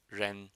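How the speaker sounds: ren, curled tongue near the palate, not touching; Chinese ren. Shown as a demonstration. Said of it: "give you the ren R sound".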